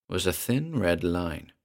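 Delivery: ordinary delivery, nothing marked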